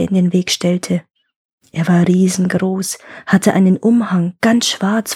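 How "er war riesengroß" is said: plain reading voice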